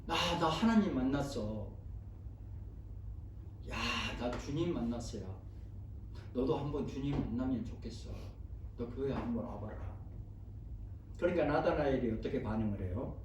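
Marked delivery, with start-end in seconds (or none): 0.1-10.0 lightly voiced friend, lower and softer; low-key, coaxing invitation to a friend; 빌립의 전도하는 말. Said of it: "찾아가서 뭐라고 말해요?". (나직이 권하는 말투)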